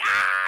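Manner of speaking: loud mock yell of pain; imitated pain cry. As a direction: yell as the jabbed creature. Creature screams during blood draw.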